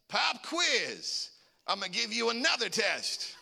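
high-pitched mock-angry announcement; spring punishment on the class; retold vengeful teacher. Math teacher to the pranksters, vengeful mock outburst.

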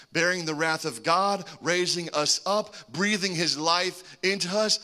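ordinary talk, nothing marked

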